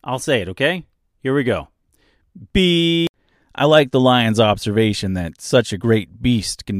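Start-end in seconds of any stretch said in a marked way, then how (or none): 2.5-3.1 high, loud spoken beep; stand-in censor-style beep; imitated beep sound. Shown as a demonstration. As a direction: voice the beep as one held tone. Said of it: "after the beep".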